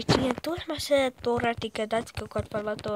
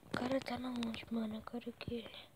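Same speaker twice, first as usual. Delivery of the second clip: whispering; 13 dB quieter than usual. Real, not acted. Whispered aside.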